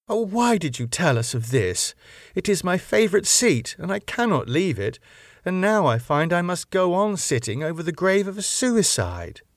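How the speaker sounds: put-on young lady upset to learn her seat is over a grave; lightly voiced young woman. Lucy speaks in a read story.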